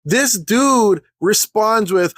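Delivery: ordinary delivery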